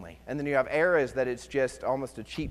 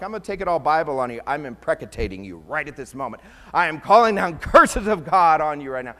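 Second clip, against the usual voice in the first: mock cursing; 8 dB louder than usual.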